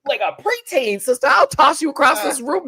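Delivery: mock threat. Real, not acted. Host mock-threatening the yelling castmate, high loud. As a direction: puff up and threaten playfully.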